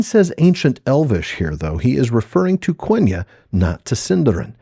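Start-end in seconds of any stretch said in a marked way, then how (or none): none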